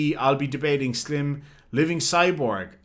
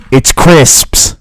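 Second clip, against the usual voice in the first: loud shout; 15 dB louder than usual. Real, not acted. Streamer shouting his crisps verdict at chat, full yell.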